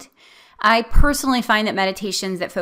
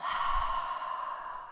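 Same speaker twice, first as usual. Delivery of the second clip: soft exhale sigh; 11 dB quieter than usual. Shown as a demonstration. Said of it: "settle on your exhale".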